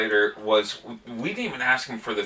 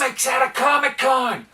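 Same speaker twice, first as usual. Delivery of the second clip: mock-amazed outburst; 11 semitones higher than usual. Real, not acted. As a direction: call it out high over the others. Sarcastic outburst interrupting the host.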